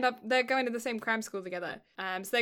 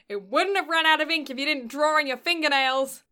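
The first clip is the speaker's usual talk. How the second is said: sharp, louder scolding retort; a teacher snapping at a pupil; 5 semitones higher, 6 dB louder than usual. Retold or imitated. Teacher snaps at child over marker, sharp scold. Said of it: "she just said it really aggressively".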